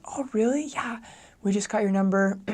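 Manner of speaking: high, friendly phone chirp; eager high-voiced caller; kindly high voice. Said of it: "my voice goes up".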